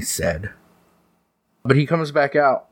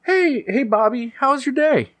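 high, sweet mother greeting her son; high mom voice; 13 semitones higher than usual. Acted. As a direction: coo it like a fond mom.

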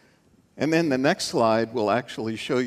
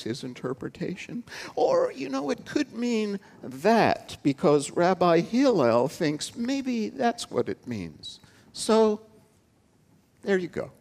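mock scholarly uncertainty, pitched up; hedging lecturing rabbi; 2 semitones higher than usual. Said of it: "other rabbis would teach something like this".

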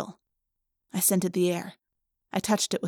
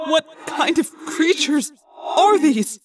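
high, loud, slow terrified cry; phobic panic; 8 semitones higher, 5 dB louder, slower than usual. Acted. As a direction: cry out in horror. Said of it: "He sounded terrified".

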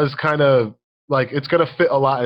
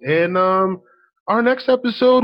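ordinary delivery

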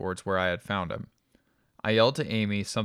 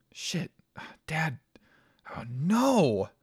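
pleading; 7 semitones higher, much slower than usual. A narrator's reading. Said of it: "I began to plead".

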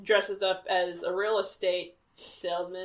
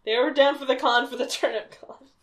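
ordinary talk

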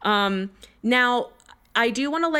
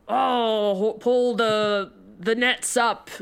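weathered fisherman; slower than usual. Shown as a demonstration. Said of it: "be like".

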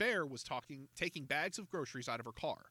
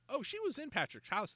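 lightly voiced Encyclopedia line, pitched much higher; a higher, lightly acted character voice; light boy detective reveal; 13 semitones higher than usual. A narrator's reading. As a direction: pipe up higher with the surprise reveal. Detective's sly reveal in dialogue.

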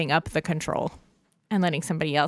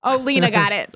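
usual speaking voice

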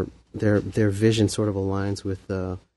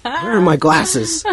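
fussy teacher; 6 semitones higher, 5 dB louder, much slower than usual. Pretend. Playing bookish teacher.

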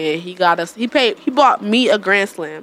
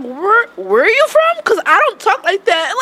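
higher, drawn-out disbelieving question; incredulous, pitched way up, stretching the question; retold bafflement; 11 semitones higher, 4 dB louder, slower than usual. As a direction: put them on the spot, baffled. Retold baffled reply to him.

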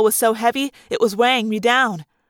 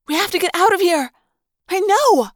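urgent; 6 semitones higher, 3 dB louder than usual. Acted. Two friends urgently fleeing a stage.

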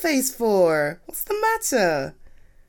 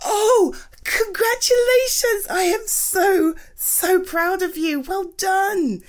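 higher, louder, slow proud praise; drawn-out heartfelt congratulations; 9 semitones higher, 4 dB louder, slower than usual. Acted. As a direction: pour pride into each word. Praising partner's win.

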